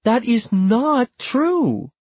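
high, slow, indignant denial; drawn-out high-pitched protest; emphatic denial. A narrator's reading. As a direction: deny it firmly, drawing out each word. Acted denial in family quarrel.

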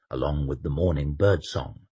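usual speaking voice

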